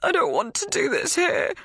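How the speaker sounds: high, tearful plea. A narrator's reading. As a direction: beg as the tears come. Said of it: "I say, tears flooding my eyes".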